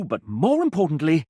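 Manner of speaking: usual speaking voice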